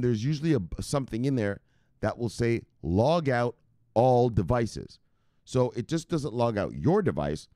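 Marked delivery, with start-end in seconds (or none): 2.8-5.0 "Log out all devices" very slowly, each word weighted; slow, deliberate stress on "Log out" and "all devices"; emphatic reading. Shown as a demonstration. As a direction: spell out "Log out all devices" at half speed. Quotes the button, stressing it.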